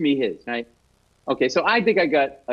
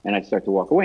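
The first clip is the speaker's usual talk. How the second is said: ordinary talk, nothing marked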